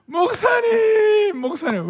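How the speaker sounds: high, loud drawn-out cry for the pastor; shouting for the pastor, near tears; frantic calling. Retold or imitated. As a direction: call out in breathless excitement. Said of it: "쫓아온 거예요".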